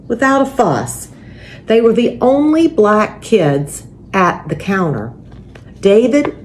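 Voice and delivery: gentle, steady storybook reading; reading a picture book to children. A narrator's reading. Pastor reading a picture book to families, gentle storybook voice.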